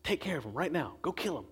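soft, pressing, hurried near the end. Acted. David's men pressing him to kill Saul, hushed and urgent. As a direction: egg him on under the breath.

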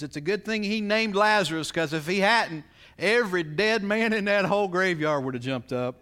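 put-on old preacher quote.

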